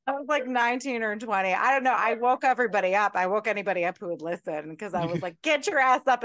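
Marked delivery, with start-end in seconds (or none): 5.4-6.2 loud, raised-pitch wake-up order; rousing yell. Retold or imitated. Retelling how they woke everybody up, mock rousing yell. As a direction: rouse a hungover group, retold. Waking everybody up after a night out.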